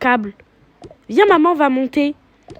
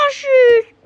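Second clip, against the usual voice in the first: whiny protest; 9 semitones higher, 4 dB louder than usual. Acted. Kid protesting unfair punishment.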